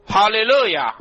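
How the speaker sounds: loud, high hallelujah cry; praise shout. Real, not acted. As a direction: call out praise to the congregation, pitched high. Preacher's exclamation of praise.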